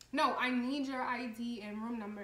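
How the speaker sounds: loud retold guard's order; insistent demand. Security guard demanding ID from the storyteller, insistent and loud. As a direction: cut in firmly and loudly as the guard.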